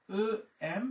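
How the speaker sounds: ordinary talk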